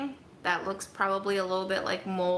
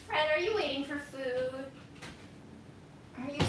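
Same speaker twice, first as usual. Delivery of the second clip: pet talk; 5 semitones higher, 5 dB quieter than usual. Acted. Talking to a pet.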